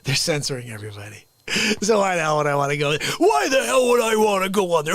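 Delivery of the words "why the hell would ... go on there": exasperated repeat. Real, not acted. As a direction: throw the question up in disbelief. Same words repeated, heated.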